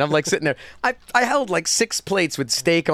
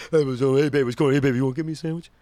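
tough guy voice; 4 semitones lower, faster than usual. Retold or imitated. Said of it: "he was a real tough guy".

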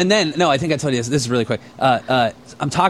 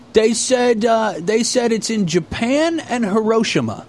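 high, slow dim-witted imitation; Ray voice; 8 semitones higher, slower than usual. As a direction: drag it out high like a clueless kid. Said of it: "And he goes, uh".

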